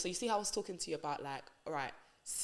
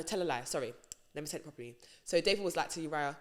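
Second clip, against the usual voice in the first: usual speaking voice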